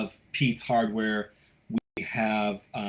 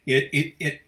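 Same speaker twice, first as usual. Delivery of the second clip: tripping over repeated first words; halting, restarting as you search for words; stammering; 3 semitones higher than usual. Real, not acted. Host struggling to say what the closing means, stammering. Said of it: "I know I'm stammering".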